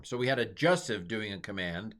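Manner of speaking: ordinary delivery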